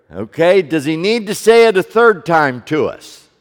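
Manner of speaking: loud, ringing preaching to the congregation; preaching at full force. Acted. Pastor preaching to his congregation, loud and ringing. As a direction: proclaim it out over the room.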